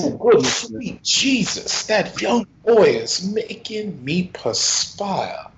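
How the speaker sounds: high, slow Southern preacher drawl; hammed-up flustered Southern clergyman; Father Onassis. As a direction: exclaim like an overheated Southern preacher. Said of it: "Oh, that's Father Onassis".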